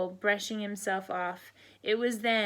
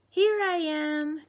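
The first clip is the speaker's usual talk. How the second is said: higher, louder little duckling squeak; duckling's cry; 7 semitones higher, 6 dB louder, slower than usual. Acted. Duckling found by Mama, squeaky cry. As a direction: pop up squeaking. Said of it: "cried Whittle".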